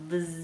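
low, long sustained bee drone; soft, steady buzzing z. Acted. Parent showing how bees go, long low buzz. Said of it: "The bees go".

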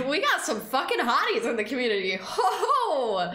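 quick delighted tease breaking into laughter; laughing, playful. Real, not acted. Streamer teasing viewers about their face reveals, laughing.

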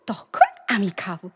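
low, slow near-whisper; soft, hushed little promise; coy promise. Acted. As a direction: whisper it coyly, drawing it out. Shy promise to treat him later.

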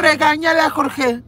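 high, mocking voice of Arturo. Imitating Arturo telling Jorge to scold her. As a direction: sneer it like the bossy colleague. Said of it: "le dice la perra del Arturo".

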